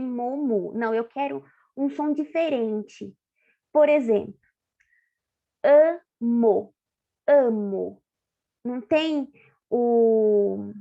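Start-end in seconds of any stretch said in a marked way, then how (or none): 5.6-8.0 "amo" split slowly into a-mo; sounding out "amo". Shown as a demonstration. Teacher to pupils, sounding out the word. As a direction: stretch "amo", letting the M start the second syllable. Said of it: "Ele tá no meio da frase".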